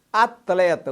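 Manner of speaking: ordinary talk, nothing marked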